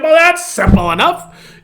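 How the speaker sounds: high, loud, laughing mock reply; mock reaction. Pastor reacts to the pipe smoker's answer, amused and high-pitched. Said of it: "It was like".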